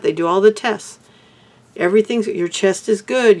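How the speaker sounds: usual speaking voice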